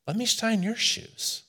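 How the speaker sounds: soft, high, humble request to a shoeshiner; tender offer. Retold or imitated. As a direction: offer it tenderly, barely above a breath. Brennan's gentle offer to shoeshiner.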